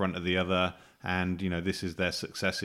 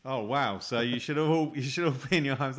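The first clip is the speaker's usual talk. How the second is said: mock festive cheer; 7 semitones higher than usual. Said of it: "That was stupid".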